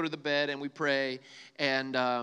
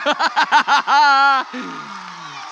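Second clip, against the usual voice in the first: loud, much higher burst of laughter; 6 semitones higher, 15 dB louder than usual. Real, not acted. Preacher cracking up at the crowd's reaction. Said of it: "Y'all are too funny".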